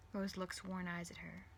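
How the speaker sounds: usual speaking voice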